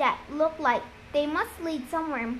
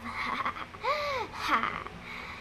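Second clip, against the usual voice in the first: soft, higher put-on laugh; drawn-out acted chuckle, kept quiet; imitated laugh; 7 semitones higher, 8 dB quieter than usual. A narrator's reading. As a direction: laugh in imitation of someone else. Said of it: "Tyson laughed like Luke's laugh".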